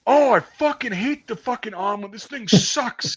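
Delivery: high, whiny griping recruit voice; whiny complaint. Retold or imitated. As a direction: whine about the omelet like a sulky kid. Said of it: "And he goes".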